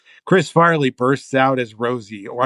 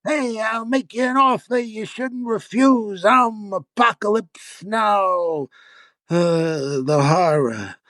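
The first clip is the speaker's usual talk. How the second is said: high, slow hammed-up Brando voice; bad Brando impression; 9 semitones higher, slower than usual. Writer acting Farley failing at Brando on stage. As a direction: do a terrible Marlon Brando. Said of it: "Chris bursts out as Brando".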